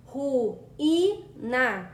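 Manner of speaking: "ru, i, na" said as separate syllables; syllables split. Shown as a demonstration. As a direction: break "ru, i, na" into single beats. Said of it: "ao separar as sílabas".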